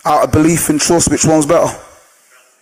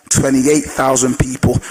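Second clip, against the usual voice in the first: ordinary talk, nothing marked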